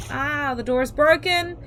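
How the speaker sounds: high, loud relieved cry; excited. Real, not acted. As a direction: shout it with relief. Relief, door finally gives.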